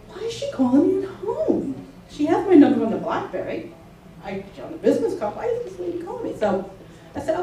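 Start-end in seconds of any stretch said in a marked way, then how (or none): 0.1-6.4 retold horror in a high, hushed voice; high-pitched, aghast disbelief, kept fairly soft; horrified. Nurse recalling a client's call at home, horrified. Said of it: "I was horrified, saying".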